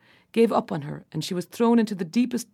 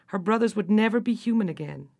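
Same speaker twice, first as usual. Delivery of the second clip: usual speaking voice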